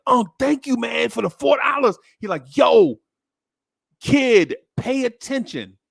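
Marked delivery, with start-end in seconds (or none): 0.1-2.0 put-on high, loud, eager kid thanking; excited kid. Boy thanks his uncle for the money in a high, excited voice. 2.6-5.8 loud, raised call for attention; stern uncle. Retold or imitated. Uncle loudly tells the distracted boy to pay attention.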